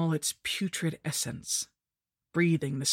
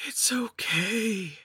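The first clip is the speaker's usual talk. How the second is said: slow, high whispered crone voice; creepy mock comfort from a hag; soothing witch; 5 semitones higher, much slower than usual. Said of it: "she said".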